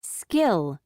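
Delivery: "Skill" with no puff of air after the k; "Skill" with an unaspirated k. Shown as a demonstration. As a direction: keep the k after s in "Skill" free of extra breath. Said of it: "we do not aspirate the sound".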